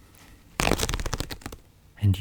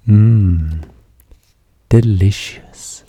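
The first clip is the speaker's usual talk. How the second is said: savouring mmm; 5 dB louder, much slower than usual. Acted. Savouring the food.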